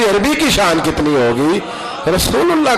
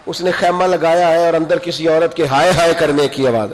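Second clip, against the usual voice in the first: ordinary delivery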